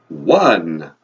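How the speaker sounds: long, falling vampire count; low, drawn-out Transylvanian accent. Acted. Count starting the counting lesson, theatrical vampire accent. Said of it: "your vampire accent".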